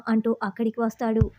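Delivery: ordinary delivery, nothing marked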